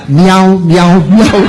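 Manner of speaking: high, loud playful meows with a laugh; mock meowing. Waiter mockingly answering the whistle, high laughing meows. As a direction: meow back at him like an annoyed cat. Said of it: "O garçom veio".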